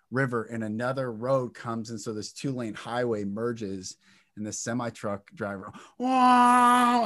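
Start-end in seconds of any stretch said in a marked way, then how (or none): 6.0-7.0 high, loud startled cry; startled shout. Retold or imitated. Truck driver yelping as the car flies past.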